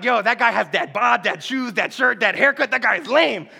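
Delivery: hammed-up casual dissing; mocking put-down. Mimics someone mocking a guy's looks.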